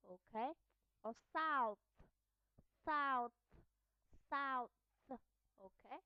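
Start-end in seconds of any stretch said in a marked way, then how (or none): 1.3-4.7 "south" ending on a voiceless TH; final TH. Shown as a demonstration. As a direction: model "south" firmly, airy TH at the end. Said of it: "at the end of the word".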